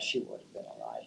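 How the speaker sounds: hushed whisper aside. Real, not acted. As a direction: whisper it under your breath. Aside about Grace's death.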